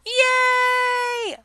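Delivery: high, loud yay; excited cheer. Acted. Vlogger cheering, high and loud. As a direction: cheer like you won. Celebrating shout.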